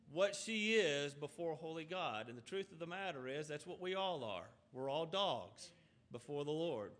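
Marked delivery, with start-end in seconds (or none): none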